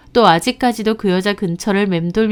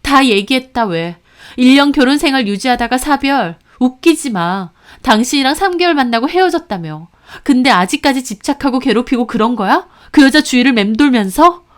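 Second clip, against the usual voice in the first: loud, higher, accusing tirade; angry retort; 5 semitones higher, 4 dB louder than usual. Acted. Wife angrily confronting her lying husband, loud. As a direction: throw his lies back at him. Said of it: "저도 화가 나더라고요".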